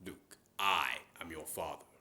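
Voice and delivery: low, measured villain voice; lightly voiced Vader; Darth Vader impression. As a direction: intone it like a masked dark lord. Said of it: "I can do Darth Vader".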